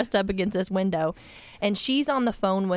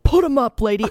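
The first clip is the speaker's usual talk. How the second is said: louder, higher gruff robber bark, laughing; mock robber threat; 4 semitones higher, 7 dB louder than usual. Daughter sneaking up on mom, mock robber threat. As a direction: play a burglar jabbing her. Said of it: "and I said".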